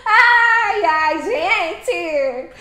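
high, loud, drawn-out sing-song gratitude; stretched, singing thank-you; excited thanks. Real, not acted. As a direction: gush with delight over the gift. Thrilled thanks for big donation.

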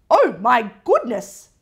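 loud shocked cry from Gran. Acted. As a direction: gasp it out loud in alarm. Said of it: "cried Gran".

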